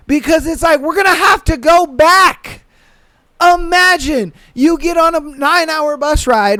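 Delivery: worked-up rising rant. Real, not acted. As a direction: push it up into a rant. Ranting about the bus ride.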